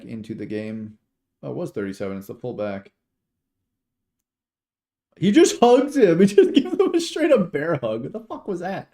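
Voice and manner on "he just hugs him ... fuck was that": incredulous outburst. Real, not acted. Streamer sees a blatant bear hug, incredulous outburst. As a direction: blurt it out, astonished and pitched up. Reacting to blatant holding penalty.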